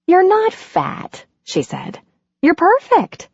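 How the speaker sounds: lightly voiced affectionate heroine; Andy, reassuring.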